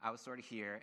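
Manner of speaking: usual speaking voice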